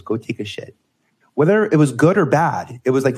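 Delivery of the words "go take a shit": saying it quietly, embarrassed; softer, a touch embarrassed; dropped voice. Retold or imitated. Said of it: "her phrase was".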